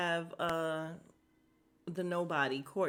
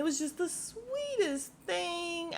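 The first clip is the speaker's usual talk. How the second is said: high, slow, melting over the sweetest moment; gushing; 9 semitones higher, slower than usual. Real, not acted. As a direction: coo it with delight.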